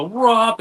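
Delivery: high, loud "whoop" mimicking a water blast; imitated whoosh. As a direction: imitate a sudden gush with a rising whoop. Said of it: "turn around and go".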